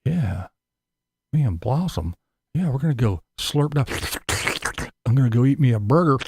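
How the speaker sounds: a lightly voiced, dozy, happy Tater; mock mellow Tater. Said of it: "he's walking around going".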